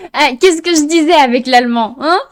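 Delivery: gleeful loud I-told-you-so; triumphant gloating. Real, not acted. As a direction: crow that you were right all along. Gloats at being proved right.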